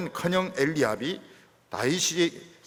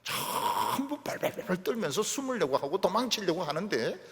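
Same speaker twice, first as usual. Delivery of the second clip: ordinary talk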